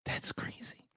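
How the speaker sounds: unvoiced whispered disbelief. Staff whispering about the naked intruder, hushed. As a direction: hush it like a shocked secret. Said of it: "But we're like".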